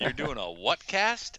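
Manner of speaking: slow, puzzled disbelief; drawn-out confused question; incredulous. Retold or imitated. Mocking Mo's confusion.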